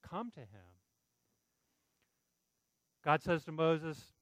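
ordinary talk, nothing marked

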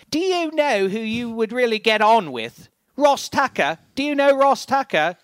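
high, loud hammed-up English accent; mock English accent. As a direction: put on a big, exaggerated English voice. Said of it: "I was able to divine what he was saying".

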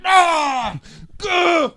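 two high, loud grunted yells; mock wrestling yells. Host answers a wrestling challenge, mock yells. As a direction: let out short high cries. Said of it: "That's what I say to that".

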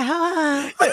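high little voice through laughter; Mike Tyson. Acted. Storyteller redoes it on request, laughing little-voice impression. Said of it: "Can you tell it in his voice".

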